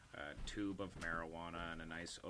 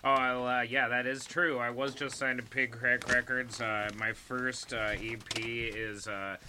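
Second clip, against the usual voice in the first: loud; 4 semitones higher, 8 dB louder than usual. Real, not acted. Guest loudly announces signing.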